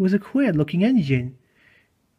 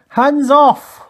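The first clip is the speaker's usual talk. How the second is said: loud, high command; shouted inspector's command; sharp order; 9 semitones higher, 8 dB louder than usual. A narrator's reading. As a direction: order them off at full voice. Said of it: "ordered the inspector".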